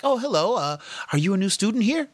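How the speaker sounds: high, mock-polite teacher greeting. Teachers greeting Raheem as a newcomer, mock-polite and high. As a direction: play a teacher meeting a stranger. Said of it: "were introducing themselves like".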